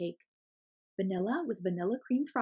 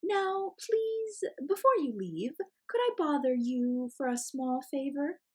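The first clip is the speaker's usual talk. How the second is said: fox; 6 semitones higher than usual. Acted. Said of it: "asked the fox".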